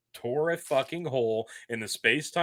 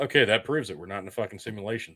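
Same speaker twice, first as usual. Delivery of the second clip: ordinary talk, nothing marked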